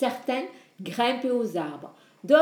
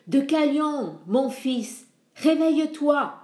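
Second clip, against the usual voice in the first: raised, calling out to a sleeping son; calling voice in the night; 6 semitones higher, 4 dB louder than usual. Acted. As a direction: call him awake from afar. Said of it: "dit la voix".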